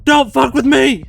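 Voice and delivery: high, full-force shout; loud, high-pitched defiance; defiant yell. A narrator's reading. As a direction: yell a warning at your attacker. Yelled threat at attacking monster.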